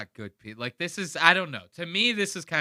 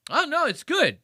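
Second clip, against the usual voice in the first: much higher, loud flustered brush-off; high mock Steve; 14 semitones higher, 4 dB louder than usual. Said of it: "the second Steve goes".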